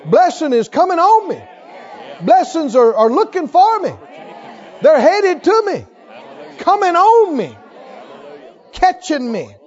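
triumphant preacher declaring blessings over himself; bold faith declaration. Shown as a demonstration. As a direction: shout the blessing in like it's already here. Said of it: "What if you talk like that".

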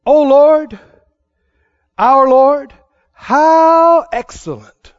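slow, declaiming read of the psalm; a loud, stretched opening O Lord; scripture reading. Real, not acted. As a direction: read it like holy text, savoring each phrase.

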